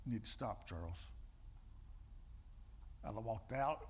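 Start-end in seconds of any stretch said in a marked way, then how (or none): 0.1-1.1 quiet firm. Retold or imitated. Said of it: "I said".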